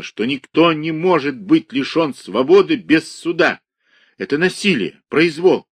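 shouting about lawless confinement; indignant outrage. A narrator's reading. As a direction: rail against the injustice. Ivan rages at detention.

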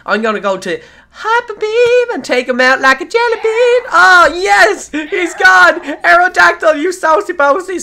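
high, loud victory yells; thrilled shouting. Real, not acted. Gamer cheering his Pokemon after a knockout, shouting. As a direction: celebrate the knockout at full voice.

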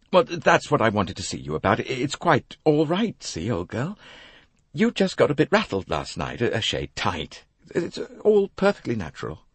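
lightly voiced hearty Englishman soothing her; reassuring chap.

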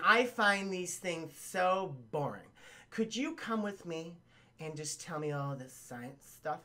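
slow, softening flirty request; coy coaxing. Retold or imitated. Felicia asking Peter along to an exhibit, coy acted coaxing.

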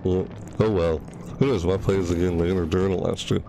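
normal talking voice

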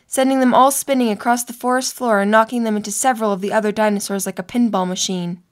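ordinary delivery

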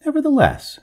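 firm, high-pitched insistence; politely unbudging. A narrator's reading. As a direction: overrule the objection firmly. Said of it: "said the master firmly".

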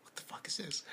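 hushed, unvoiced what the fuck; under your breath, stunned; whispered disbelief. Awkward silence in the classroom.

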